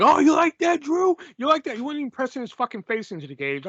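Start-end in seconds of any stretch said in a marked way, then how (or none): 0.0-1.7 higher, louder jeering taunt; mock taunting. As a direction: act out a cocky wrestler goading his opponent. Mocking wrestler's taunt to opponent.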